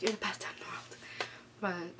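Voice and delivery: soft unvoiced whisper. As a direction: breathe it out gratefully. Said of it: "I was like".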